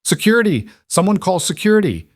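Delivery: high, loud call for security; a panicked mother calling out to the crowd; shouting for help. Acted. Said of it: "The woman's voice rose".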